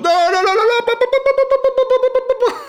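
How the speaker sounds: very high, rapid no no pa pa; high-pitched babbling protest; frantic mock refusal. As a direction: squeal out a panicked refusal. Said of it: "Enfin, je lui fais pas comme ça".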